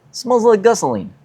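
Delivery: high-pitched mock Russian accent; horrible Russian accent. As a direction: do a bad, high Russian neighbor impression. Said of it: "I'm just doing a Stanley impression".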